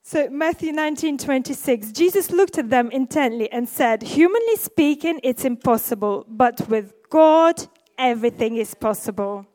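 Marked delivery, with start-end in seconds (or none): 4.0-9.5 loud, slowed emphasis on with God; scripture proclaimed from the pulpit; preaching scripture. Acted. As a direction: proclaim the verse with force.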